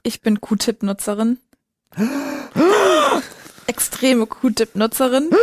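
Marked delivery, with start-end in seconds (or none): 2.0-3.2 mock gasp. Mock horror at Q-Tip confession.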